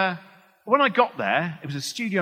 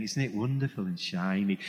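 soft, low, wonderstruck science presenter; Brian Cox; 6 semitones lower, 6 dB quieter than usual. Acted. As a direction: marvel quietly in a lowered impersonation. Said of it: "I work quite a lot with Brian Cox".

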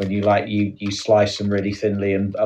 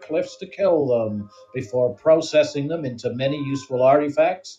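lightly hammed-up American presenter accent; a mild cheesy American host; 2 semitones higher than usual. Telling Sam fake buffalo facts, light American accent. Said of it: "we're doing accents again".

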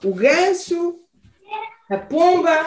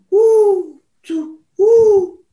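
spooky wail; 6 semitones higher, 6 dB louder, much slower than usual. A narrator's reading. The horrible sound again.

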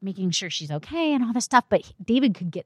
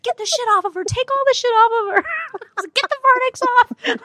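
high, bossy yelled directives; mock shouted orders; 4 dB louder than usual. Half-paralyzed mother shouting directives at the medical staff, mock bossy. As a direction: bark orders at the doctors. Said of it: "shouting these directives to professionals".

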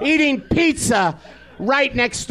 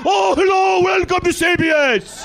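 put-on Scottish accent; 6 semitones higher than usual. Comic mocking Ferguson's greeting, hammed-up Scottish accent. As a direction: ham up a Scottish talk-show welcome. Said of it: "Why do we have a guy going".

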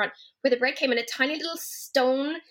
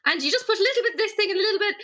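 high, quick Irish mammy voice; affectionate high-pitched impression of mother; imitated mother; 8 semitones higher, faster than usual. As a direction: mimic your mum rattling off a recipe. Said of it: "but then she's like".

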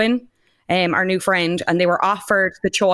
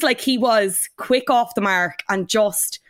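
normal talking voice

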